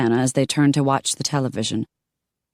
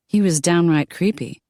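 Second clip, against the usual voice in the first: usual speaking voice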